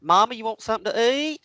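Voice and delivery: mock country voice to mama; country accent to mom. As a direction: switch into thick country talk. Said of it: "And then I'd".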